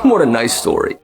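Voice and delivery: normal talking voice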